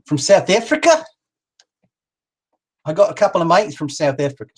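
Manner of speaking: loose South African accent; attempted South African accent. Shown as a demonstration. Host greeting a viewer from abroad, attempted South African accent. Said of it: "I'm not doing my South African accent very properly".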